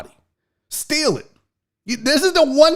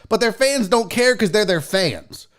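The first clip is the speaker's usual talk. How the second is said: ordinary talk, nothing marked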